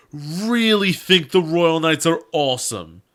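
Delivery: mock awe at the Royal Knights. Real, not acted. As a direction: drag it out like a smitten fan, sarcastic. Mocking the dev team.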